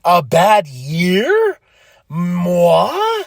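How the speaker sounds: slow, drawn-out indignant diva; haughty mock offense; mock diva outrage. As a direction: stretch each word out, offended. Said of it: "She goes".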